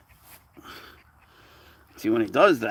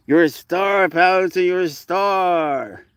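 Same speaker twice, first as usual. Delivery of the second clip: high and loud, stretched out last words; drawn-out praise for a good kitty; praising pet; 8 semitones higher, 6 dB louder, much slower than usual. Real, not acted. Owner praises her cat as a star, high and drawn out.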